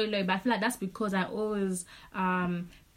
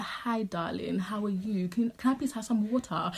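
softer, put-on British politeness; fake British accent; 3 dB quieter than usual. Shown as a demonstration. Showing friends the voice she could use, fake British accent. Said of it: "fake British accent. If I really wanted to, I could be like".

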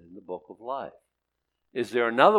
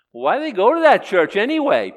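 loud preaching question; 10 semitones higher, 12 dB louder than usual. Real, not acted.

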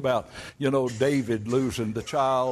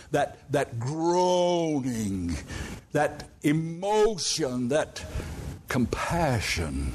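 very slow, heavy "groaning", "emotion", "compassion"; slow emphasis; 2 semitones higher, much slower than usual. Shown as a demonstration. Preacher dwelling on Jesus' groaning, slow and weighty. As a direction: lean on each word slowly. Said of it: "hard to define".

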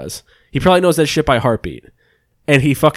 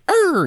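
high squawk imitating a falling bird; imitated bird noise. Retelling the bird's fake death, high imitated squawk. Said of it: "He'd make a noise".

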